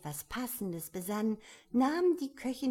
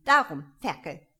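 a louder, sharp mock snap at the baby; heftig; 11 dB louder than usual. A narrator's reading. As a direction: spit the word out with force. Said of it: "sagte sie mit solcher Heftigkeit". (heftig und laut, gespielt)

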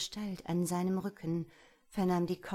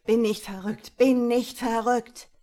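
building shout; 4 semitones higher, 11 dB louder than usual. Acted. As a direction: build into a desperate cry.

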